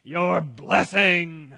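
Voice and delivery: hammed-up cheerful son, loud and high; big cheery call, drawn out at the end. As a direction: burst in proudly with your gift. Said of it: "he walks in all cheerfully and says".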